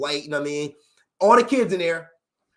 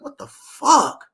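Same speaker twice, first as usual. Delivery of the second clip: drawn-out, slow cry of despair; groaning it out in disbelief; anguished what the fuck; 8 semitones higher, 7 dB louder, much slower than usual. Retold or imitated. Young ex-con venting despair at his situation, slow anguished cry. Despair over life after prison.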